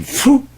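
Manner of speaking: loud high relieved exhale; high loud phew. Retold or imitated. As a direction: blow it out like you just escaped. Exhale after escape.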